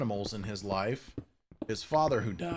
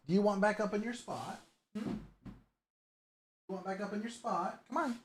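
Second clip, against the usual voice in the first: high, soft cooing at the cat; 8 semitones higher, 5 dB quieter than usual. Real, not acted. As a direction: coo her back to her spot. Baby talk to the cat.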